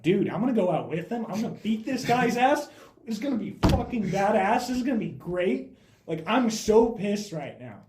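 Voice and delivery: loud, high, pumped-up rant; acting out worked-up bravado; fired-up. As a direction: hype yourself up for a fight, pitch way up. Retells himself fired up to fight.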